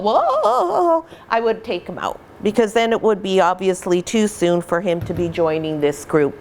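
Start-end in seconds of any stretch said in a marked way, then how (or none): 0.0-1.1 high laughing whoa as the startled puppies; playful, squeaky mock alarm. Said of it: "they were all".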